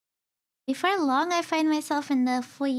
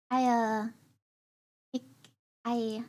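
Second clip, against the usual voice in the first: halting, broken-off words; voice failing; 3 semitones lower than usual. Acted. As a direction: struggle to get words out. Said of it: "I can't form a sentence".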